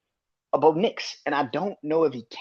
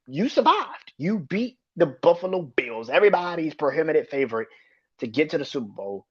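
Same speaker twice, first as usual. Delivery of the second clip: ordinary talk, nothing marked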